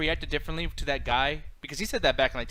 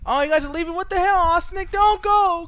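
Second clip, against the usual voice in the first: much higher, louder playful wail; mock-desperate plea; 12 semitones higher, 8 dB louder than usual. Real, not acted. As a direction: beg them not to leave, hammed up. Pleading with leaving viewers.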